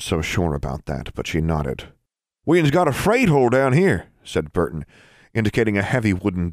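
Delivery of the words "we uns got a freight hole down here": put-on high, loud boyish voice; eager boy voice. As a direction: pitch Burton up and eager.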